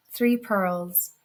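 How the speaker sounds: plain reading voice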